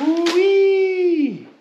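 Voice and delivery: high loud whoop of delight; excited woo-wee. Real, not acted. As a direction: whoop at the sneakers. Excited at the shoes.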